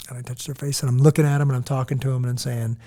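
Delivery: normal talking voice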